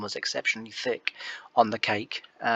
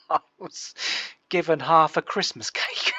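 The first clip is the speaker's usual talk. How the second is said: laughing confession; 5 semitones higher than usual. Real, not acted.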